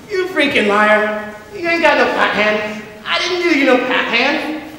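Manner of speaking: put-on a furious kid yelling cheat across the cards; furious accusation. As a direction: accuse him, red-faced. Teddy accusing Chris.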